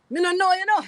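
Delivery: high, light fake Jamaican accent; tossing off a line in a fake Jamaican accent; a streamer playing a fake Jamaican for chat, lightly mock Jamaican. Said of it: "The fake Jamaican just don't know".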